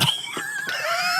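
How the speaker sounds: a sudden shrieking burst of laughter; high squealing laugh. Real, not acted. Recalling rumours about being fired, shrieking laugh. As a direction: laugh out high at how absurd it was.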